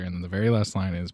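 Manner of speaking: usual speaking voice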